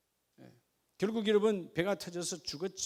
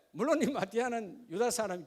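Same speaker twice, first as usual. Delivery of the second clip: ordinary delivery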